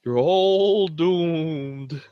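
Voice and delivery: slow doom-laden prophet warning; slow ominous raspy drawl. Retold or imitated. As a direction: drag out each word like a madman. Imitating Crazy Ralph's warning.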